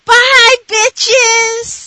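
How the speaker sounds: high, loud shouted sign-off; calling out. Pretend. Co-host offering the show's closing line, calling out. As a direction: holler a brash farewell.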